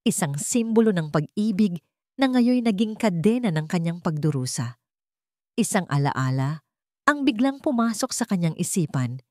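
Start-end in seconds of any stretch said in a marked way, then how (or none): none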